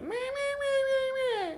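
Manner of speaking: slow, very high nasal bleat; mock shrill voice. Shown as a demonstration. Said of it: "I think shrill is like".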